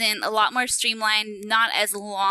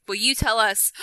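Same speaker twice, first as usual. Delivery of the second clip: ordinary talk, nothing marked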